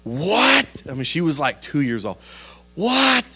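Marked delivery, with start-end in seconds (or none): 0.0-0.7 high, loud, rising What?; shocked disbelief. Retold or imitated. Shocked parent in retold story. 2.8-3.2 very high, loud, incredulous question; hammed-up parental shock; shocked disbelief. Shocked parent asks once more.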